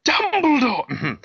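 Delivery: slow, high, drawn-out dramatic cry; dramatic reveal. Acted. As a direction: stretch the name like a grand reveal.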